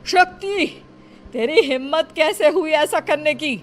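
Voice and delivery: high, sharp furious shout; angry scolding. Acted. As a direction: yell at her in outrage. Elder catches Shakti, scolds.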